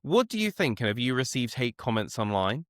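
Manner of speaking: normal talking voice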